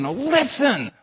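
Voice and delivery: high, pressing plea; urgent high call to listen; urgent grab. Retold or imitated. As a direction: grab them by the collar with your voice. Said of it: "grabbing them and saying".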